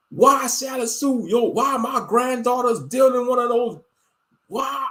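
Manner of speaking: a fed-up father snapping back at the grandfather; exasperated dad. Retold or imitated.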